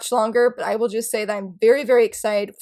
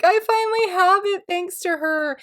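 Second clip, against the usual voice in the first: gleeful; 8 semitones higher than usual. Retold or imitated. Retelling joy at friend's gift.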